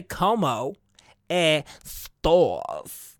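slow, drawn-out 'estás' as 'es-stawrs'; pause, then round out 'estás' long; lips moving. Shown as a demonstration. Host showing lip movement to listeners, exaggerated 'estás'. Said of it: "gotta move your lips".

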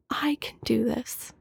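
whispered self-encouragement, barely voiced; soft whisper. Man whispering to himself to get through illness, soft whisper. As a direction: murmur it softly, like a private mantra. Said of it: "Really softly, just, like, whisper".

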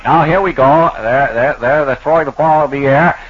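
announcer. Acted. Mock basketball play-by-play.

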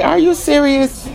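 high, disbelieving cry; incredulous. Retold or imitated. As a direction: ask it in total disbelief. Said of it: "I'm like".

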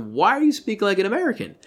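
high, fast baffled question; incredulous. Real, not acted. Ranting to a co-host about the lead's accent, incredulous high question. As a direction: pitch it way up in disbelief. Mocking the film's accent choice.

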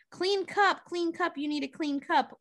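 high, insistent repeat; mock fussy hatter; bossy hosts. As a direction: shoo her along in a high voice. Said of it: "They're like".

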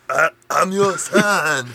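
mock monster son. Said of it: "It would be like".

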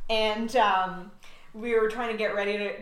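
ordinary delivery

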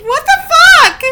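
very loud, high-pitched outburst; shocked yell. Real, not acted. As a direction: yell it in utter disbelief. Baffled by absurd tweet.

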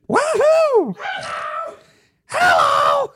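very high squeaky shouted cartoon mushroom; Toad voice. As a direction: shriek it out like tiny Toad. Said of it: "can you do your best Toad impression right now".

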